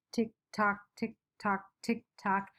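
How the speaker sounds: soft clock tick tock; clock sound. Shown as a demonstration. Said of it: "I formed the word from the sound that the clock is making".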